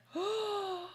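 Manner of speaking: sharp high shocked gasp; a sudden startled intake of breath. Real, not acted. Shocked reaction mid-story.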